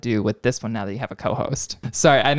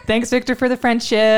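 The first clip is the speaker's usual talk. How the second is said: high mock thanks; 10 semitones higher, 4 dB louder than usual.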